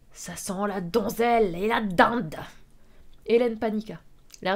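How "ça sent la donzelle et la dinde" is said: slow, drawn-out villain voice; leering villain. Acted. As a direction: draw it out like a villain sniffing prey. Voices leering villain sniffing prey. (voix de méchant, lente et traînante)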